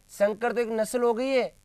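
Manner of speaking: normal talking voice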